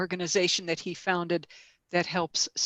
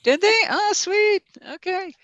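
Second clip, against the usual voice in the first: high, bright surprised delight; pleasantly surprised; 14 semitones higher, 5 dB louder than usual. Real, not acted. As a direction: react with happy surprise. Surprised the link posted.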